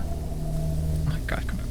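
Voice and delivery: breathy scared whisper. Real, not acted. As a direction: hush it under your breath. Whispered reaction in horror game.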